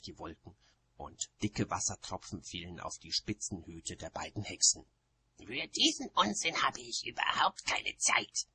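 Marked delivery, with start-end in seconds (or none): 5.4-8.5 high, sharp dismissal; cutting, partly whispery retort. A narrator's reading. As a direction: dismiss it sharply with raised pitch. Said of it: "sagte Oma Wetterwachs scharf".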